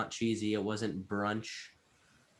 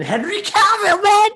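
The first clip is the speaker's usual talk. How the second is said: loud, much higher cry of the name; excited; 12 dB louder than usual. Real, not acted. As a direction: shout the name in delight. Raving about the actor.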